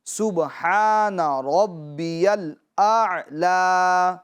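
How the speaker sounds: drawn-out, melodic tasbih recitation; slow recitation. Shown as a demonstration. Teacher demonstrating the sujood tasbih, slow and melodic. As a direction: chant it slowly so the children can follow. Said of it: "سنتے اور سیکھتے ہیں".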